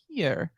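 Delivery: «Pier» with a hard P, said like peer; «Pier» said softly with a hard P; Bavarian hard P. Shown as a demonstration. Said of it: "for the hard P sound".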